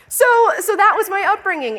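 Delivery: real laughter then words; laughing. Real, not acted. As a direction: laugh off the punchline. Laughing at mom's punchline.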